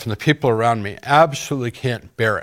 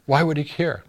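usual speaking voice

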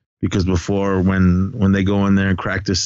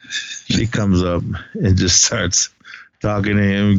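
ordinary delivery

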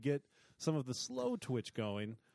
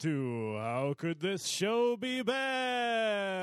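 slow, drawn-out show opener; announcer intro; 4 semitones higher, 3 dB louder, slower than usual. Acted.

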